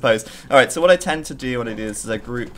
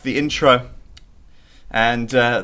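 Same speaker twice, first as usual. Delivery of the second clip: normal talking voice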